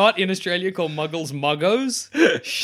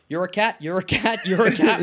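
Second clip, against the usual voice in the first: laughing mock spell; 3 semitones higher than usual.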